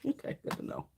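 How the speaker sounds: a dry, whispered "okay, good to know"; mock-hurt aside, said under the breath; whispered sarcasm. Answering mother's ball buster remark.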